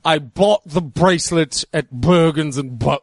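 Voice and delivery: a hint of a comic heavy voice on the B-words; fat-person voice bit. Mocking a joke to his co-host, lightly voiced comic fat guy. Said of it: "you can tell when a person is fat by how they say".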